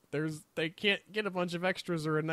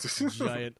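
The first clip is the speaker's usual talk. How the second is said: put-on Russian accent; 7 semitones lower than usual. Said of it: "I'm doing a Russian accent".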